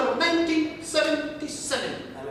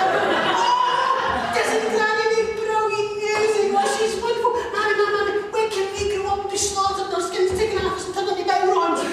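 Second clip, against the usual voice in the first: high, loud, giddy little goat voices with laughter; high squealing kid goats begging their mammy; excited kid goats; 8 semitones higher, 4 dB louder than usual. Acted. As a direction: squeal with delight like baby goats.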